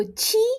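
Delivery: qi said high like chee; Mandarin qi. Shown as a demonstration. Teacher repeating Mandarin qi for class. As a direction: stress qi sharply high. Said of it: "Q I is pronounced as qi".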